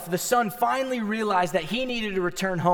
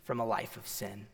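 much softer and lower, almost a murmur; hushed verse; 9 semitones lower, 8 dB quieter than usual. Acted. Pastor reading Jesus' words to the church, hushed. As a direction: drop to a near hush for the promise. Quoted verse dropped to hush.

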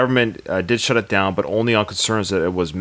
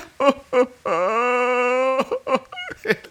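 high, hammed-up crying sob; fake sobbing cry. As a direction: sob theatrically over the coffin.

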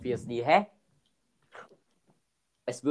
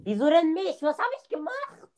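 indignant outcry; 13 semitones higher than usual. Real, not acted. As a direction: protest being chased, voice shooting up. Killer chases him, indignant.

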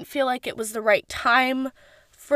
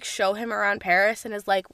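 usual speaking voice

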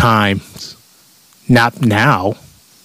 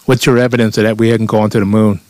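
ordinary talk, nothing marked

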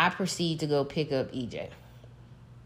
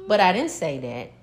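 normal talking voice

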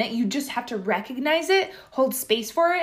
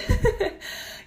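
embarrassed laugh; 10 semitones higher than usual. Real, not acted. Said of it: "wow, my voice just cracked".

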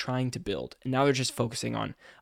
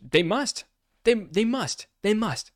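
emphatic; 9 semitones higher, 4 dB louder, slower than usual. Real, not acted. Emphatic agreement about companies.

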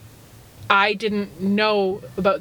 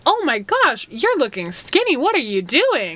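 gushing; 5 semitones higher than usual. Retold or imitated. Gushing compliment to a friend.

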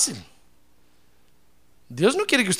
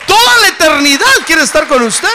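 high, loud shout of praise; exultant preaching; 11 semitones higher, 9 dB louder than usual. Real, not acted. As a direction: burst into full-force exultation. Preacher exults over eternity. (exclamación exaltada de predicador)

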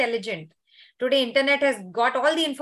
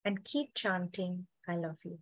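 guided meditation; 2 semitones lower than usual. Acted.

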